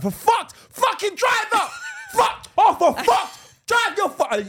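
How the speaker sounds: high, loud road-rage yelling; frustrated shout. Retold or imitated. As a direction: yell at the car in front, half laughing. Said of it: "I'm like".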